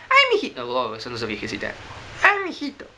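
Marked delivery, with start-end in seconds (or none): 0.1-0.7 put-on very high, thin old lady; attempted old woman falsetto; little old lady voice. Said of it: "con su voz de viejecita". 2.2-2.9 high falsetto granny; put-on old woman squeak; little old lady voice. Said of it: "con su voz de viejecita".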